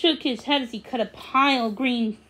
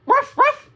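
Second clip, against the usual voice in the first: high, playful yappy puppy bark; 12 semitones higher, 6 dB louder than usual. Acted. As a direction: bark it like an excited little dog. Maggie the puppy barks.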